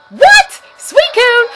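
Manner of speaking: high, loud excited call; excited shout. Real, not acted. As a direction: yell the name in sudden delight. Spotting a rare Pokemon.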